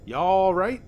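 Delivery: put-on friendly old Southern lady calling through the window; Southern drawl, sweet and neighbourly. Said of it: "she asked in a southern drawl".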